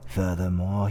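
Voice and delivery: ordinary delivery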